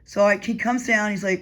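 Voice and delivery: normal talking voice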